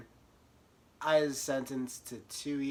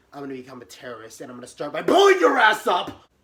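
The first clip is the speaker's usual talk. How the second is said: furious threat; 11 dB louder, faster than usual. Retold or imitated. Threatening the attorney in her head, building to a yell.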